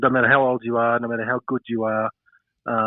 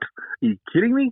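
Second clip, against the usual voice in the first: incredulous surprise; 12 semitones higher than usual. Retold or imitated. Retelling his reaction to the England invite, incredulous and higher. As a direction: pitch leaps higher on a disbelieving question. Retold reply to brother's invitation.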